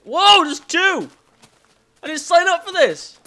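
high panicked shout. Real, not acted. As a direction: yelp in alarm. Two creepers appear.

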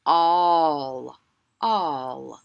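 all repeated loudly for the class; sight word repeated. Shown as a demonstration. Teacher repeating sight word for children to copy. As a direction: call out all for kids to echo. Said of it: "Can you say all?".

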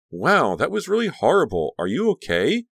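high, animated worried voice; shocked concern. A narrator's reading. Caroline to Danielle after the fight, shocked concern.

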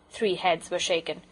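plain reading voice

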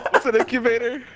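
high, laughing disbelief; laughing mock question. Real, not acted. Teasing a friend who said incubator, high and laughing. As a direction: giggle through the question.

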